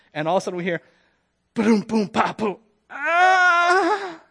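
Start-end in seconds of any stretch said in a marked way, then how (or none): 1.5-2.6 tumbling crash noises, louder; quick, punchy booms and pops; imitated crash. 2.9-4.2 long, high, loud yell; drawn-out mock scream; imitated falling scream. Imitating pastor's scream as he falls.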